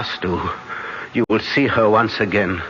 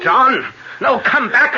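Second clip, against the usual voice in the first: urgent shout; 3 dB louder, slower than usual. Acted. Professor stops John rushing.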